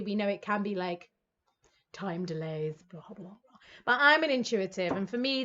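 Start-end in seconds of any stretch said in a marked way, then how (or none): none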